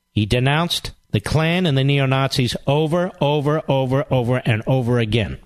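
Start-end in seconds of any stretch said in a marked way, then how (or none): none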